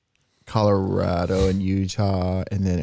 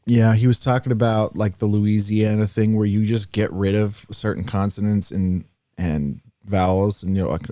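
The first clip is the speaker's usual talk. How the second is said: usual speaking voice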